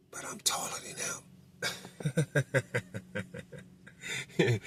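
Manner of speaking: imitating a furtive whisper. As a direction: whisper it so he can't hear you. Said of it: "so he lowered his voice".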